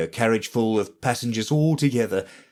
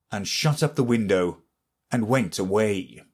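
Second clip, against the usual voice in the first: usual speaking voice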